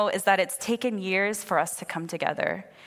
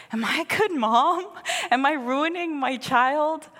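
anxious self-doubt; 6 semitones higher than usual. Retold or imitated. Voicing her mom-guilt self-doubt.

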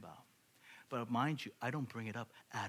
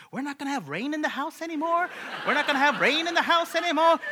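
put-on high, loud childlike shouting, building; excited kids; 13 semitones higher, 14 dB louder than usual. Kids learn the leak will end, loud high excitement. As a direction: shriek it like overjoyed kids, louder on the repeat.

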